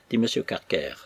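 usual speaking voice

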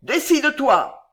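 loud, high shouted order; hurlement. A narrator's reading. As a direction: yell the command. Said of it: "hurla Belgodère". (ordre hurlé)

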